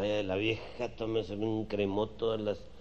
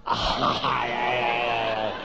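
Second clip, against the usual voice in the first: mock moaning; 8 dB louder, much slower than usual. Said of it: "exactamente como la nena".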